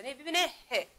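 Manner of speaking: ordinary talk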